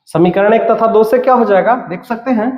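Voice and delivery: ordinary delivery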